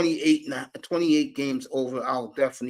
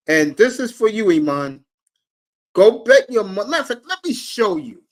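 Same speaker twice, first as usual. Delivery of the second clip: high and loud, hyping the bet; shouting a betting tip to his friend Iman; excited hype; 6 semitones higher, 8 dB louder than usual. Real, not acted. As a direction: pump the crowd up about the bet.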